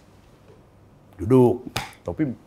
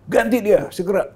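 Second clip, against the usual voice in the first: higher, louder mock angry command; mock presidential demand; imitating the president; 12 semitones higher, 7 dB louder than usual. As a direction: bark the order like an irritated boss. President's order retold.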